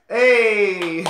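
loud hype shout. Real, not acted. Shouting along with the video.

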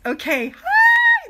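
long, loud, high-pitched slow greeting; excited greeting. Real, not acted. Coach greeting viewers, bursting with excitement.

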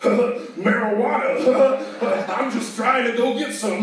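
drawn-out giggly stoner laugh; Seth Rogen impression. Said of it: "Seth Rogen".

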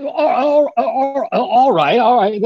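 Watson, rushed by Holmes's orders about the snow, stammers agreement; flustered stammer. Acted.

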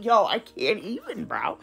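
breathy defeated whisper, dropping to nothing; whispered exasperation. Real, not acted. Vlogger to viewers after losing her voiceover, whispered defeat. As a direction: whisper it, giving up on the lost recording.

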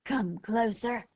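slow coyote snarl. A narrator's reading. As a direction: draw it out as a menacing snarl. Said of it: "snarled the voice".

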